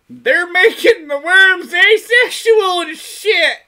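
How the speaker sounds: very high, slow shout; hammed-up conspiracy yell. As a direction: holler it like an outraged crackpot. Mock conspiracy outburst after frog talk.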